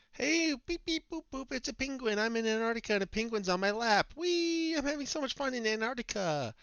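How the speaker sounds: high silly voice, giddy; silly clown. Pretend. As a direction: play a giddy goofball tourist. Voicing the blogger in photo.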